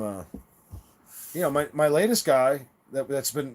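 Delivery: ordinary delivery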